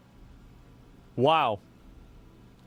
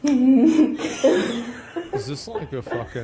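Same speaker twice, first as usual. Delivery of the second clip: laughing; 7 semitones higher, 5 dB louder, much slower than usual. Real, not acted. Laughing at absurd clip.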